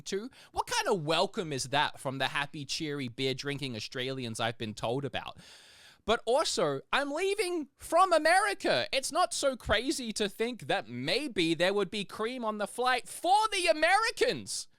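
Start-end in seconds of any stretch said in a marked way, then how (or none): 6.0-14.7 high, loud indignant protest; indignant rant. Retold or imitated. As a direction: build to an exasperated, high-pitched outburst. Indignant rant retold from flight.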